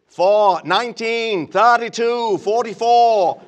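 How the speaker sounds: loud, slow New England football call; New England accent. Retold or imitated. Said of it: "how was that New England accent".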